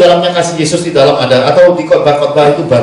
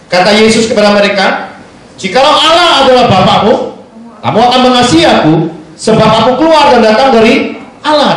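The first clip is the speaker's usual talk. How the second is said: raised, forceful Bible reading with "kamu" hammered; scripture reading; 4 semitones higher than usual. Acted. Preacher reading James to the church, forceful and raised. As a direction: read it like a preacher driving the verse home. Reads John 8 aloud.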